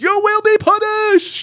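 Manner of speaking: very high, loud, drawn-out mock threat; slow hammed-up shriek of vengeance; imitated yell. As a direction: scream it out slowly at full pitch. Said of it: "he yells".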